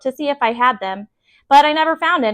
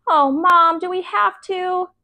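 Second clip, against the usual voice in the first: higher, slow whiny plea; whining; 7 semitones higher, slower than usual. A narrator's reading. Peter whining to his mom about babysitting, reluctant. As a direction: whine like a reluctant kid.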